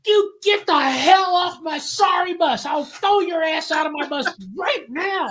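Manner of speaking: high, drawn-out enraged imitation. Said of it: "He jumps up and he screams".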